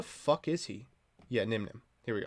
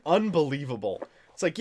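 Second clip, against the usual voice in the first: ordinary talk, nothing marked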